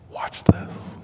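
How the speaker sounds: hushed, unvoiced whisper. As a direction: whisper it in close to a child. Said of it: "and whispers".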